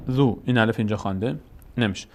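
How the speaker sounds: ordinary talk, nothing marked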